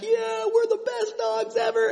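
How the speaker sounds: very high voiced happy dog; dogs answering. Preacher voicing his happy dogs in a very high voice. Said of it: "they're just like".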